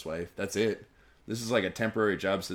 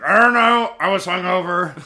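mocking whine; 9 semitones higher, 12 dB louder than usual. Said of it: "That's always gonna be your excuse".

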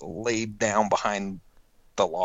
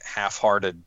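normal talking voice

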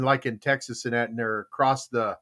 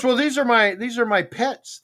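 fisherman; 8 semitones higher than usual. Retold or imitated. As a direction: play dumb about the fish. Fisherman voiced in joke.

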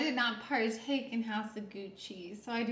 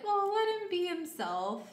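higher-pitched imitation of a protective mother; pleading mom; 8 semitones higher than usual. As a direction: voice a mother sticking up for her kid. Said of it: "and the mom was like".

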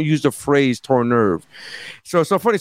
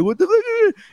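shocked; slower than usual. Retold or imitated. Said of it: "And we're like".

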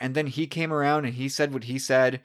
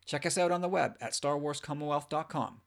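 announcer voice; 9 dB quieter than usual. Acted. Recorded podcast intro.

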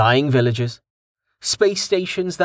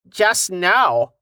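goblin voice; 6 semitones higher than usual. A narrator's reading. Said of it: "he shrugged".

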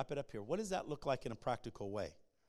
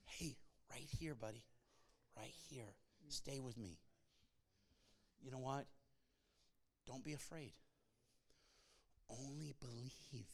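hushed reassurance; 2 semitones lower, 15 dB quieter than usual. Acted. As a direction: gently draw a frightened friend's focus back to you. God voiced calming, refocusing someone.

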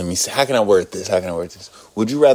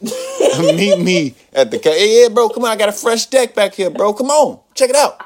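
high, eager come-on to a buddy; urgent calling over; 11 semitones higher than usual. Acted. Player luring a friend to the car, urgent high call. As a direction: beckon him over, pitched way up.

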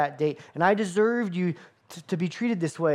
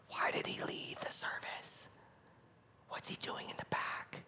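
hushed gossiping whisper; soft unvoiced whisper behind a hand; 17 dB quieter than usual. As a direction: whisper it like a nosy churchgoer. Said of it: "They may whisper".

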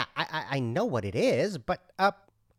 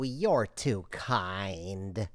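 mock gracious thanks; 8 semitones lower, 5 dB quieter, much slower than usual. Customer, slow and lower.

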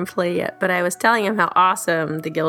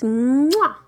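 blowing an air kiss; 6 semitones higher than usual. Real, not acted. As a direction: blow a big kiss to the listeners. Kiss after hugs and kisses.

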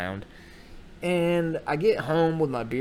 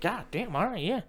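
cowed backing off; 7 semitones higher than usual. Acted. Backing off from demonic woman.

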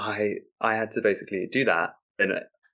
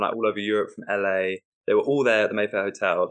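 usual speaking voice

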